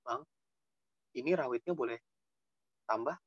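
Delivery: soft, meek asking; mild-mannered customer request; polite request. Shown as a demonstration. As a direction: ask gently, keeping the voice down. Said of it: "yang begitunya itu maksudnya".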